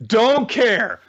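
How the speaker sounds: high, loud blurt of indifference; loud dismissal. Retold or imitated. Fan bored by a long bullet analysis, loudly dismissive. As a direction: burst out, brushing it all off. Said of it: "I was just like".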